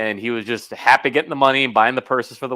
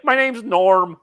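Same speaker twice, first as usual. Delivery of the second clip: raised-pitch film impression; movie character; 6 semitones higher, much slower than usual. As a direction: quote the character in a put-on higher voice. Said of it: "one of those motherfuckers on to something about Mary".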